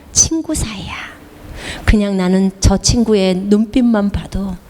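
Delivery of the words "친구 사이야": whisper. Acted. Whispered intimate line.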